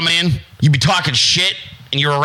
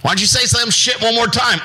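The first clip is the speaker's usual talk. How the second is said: mock older boy threat; 7 semitones higher than usual.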